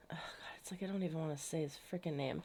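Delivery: hushed, voiceless, quick muttered aside; disgusted whisper aside. Real, not acted. Vlogger whispering aside to viewers, disgusted at Ben's name. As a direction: breathe it out like you can't bear the name.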